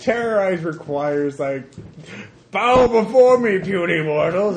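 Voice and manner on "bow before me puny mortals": booming high villain command; dark lord. Shown as a demonstration. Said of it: "it requires some vocalization".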